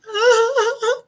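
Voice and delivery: high laugh cracking through a mock sob; laugh breaking fake cry. Real, not acted. Laughing as her fake crying falls apart, high laugh.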